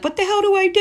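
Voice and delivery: high panicked yelp of "what the hell do I do"; a sudden high cry of fright on the phone. Retold or imitated. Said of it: "I'm freaking out".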